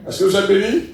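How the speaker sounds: ordinary talk, nothing marked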